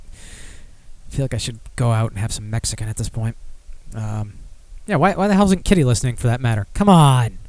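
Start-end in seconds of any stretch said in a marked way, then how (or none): 0.0-3.4 soft whispered aside. Real, not acted. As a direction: whisper it like a private thought. Quiet aside after music ends.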